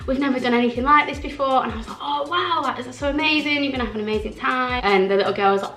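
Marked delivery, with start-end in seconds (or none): 0.1-1.6 retold kids' voice, pitched up and sing-out keen; excited kids. Kids telling cast member about first time here, excitedly. 2.0-4.8 put-on high, wowed voice for children; retold gushing amazement, pitched well up; excited for kids. Cast member cheering on kids' first holiday, excitedly.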